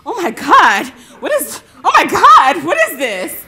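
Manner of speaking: high, loud panicked yelling; mock panic. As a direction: imitate a man startled by an attacker. Acting out man being attacked.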